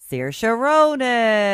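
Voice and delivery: loud, high, hugely drawn-out name; mock-dramatic reveal. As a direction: announce it grandly, stretching the last word. Triumphant reveal of the picture.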